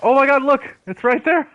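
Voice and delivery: excited discovery. Real, not acted. Player suddenly spotting the loot, excited shout. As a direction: point it out with a burst of thrill. Spots the lost item again.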